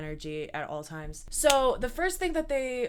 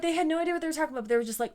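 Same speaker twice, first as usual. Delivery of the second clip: ordinary delivery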